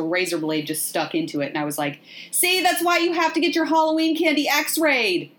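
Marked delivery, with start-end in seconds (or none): 2.3-5.3 high-pitched told-you-so lecture; mock scolding. Podcaster lecturing about razor blades in Halloween candy, mock scolding. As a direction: scold from on high, half in jest.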